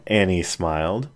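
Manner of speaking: ordinary delivery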